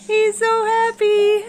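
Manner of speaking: cooed high and slow, bird talk; high cooing. Real, not acted. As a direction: coo it up high to the pet bird.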